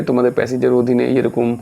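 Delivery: ordinary talk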